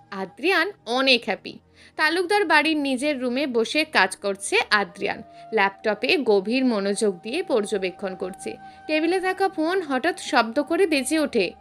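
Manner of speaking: ordinary delivery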